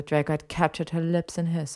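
ordinary delivery, nothing marked

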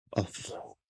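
unvoiced murmur; doctor murmuring under his breath. A narrator's reading. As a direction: breathe the words out, hardly voiced. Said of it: "mormolta Yue".

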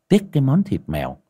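plain reading voice

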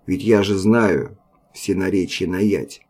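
usual speaking voice

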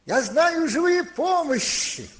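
high, slow, ringing proclamation; loud calling out. Retold or imitated. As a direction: cry it out to the whole crowd. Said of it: "громким голосом говорит".

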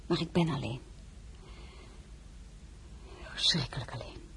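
soft, desolate confession sinking away; forlorn. Acted. Princess confessing loneliness to young count, forlorn. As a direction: let the second phrase drop almost to a whisper.

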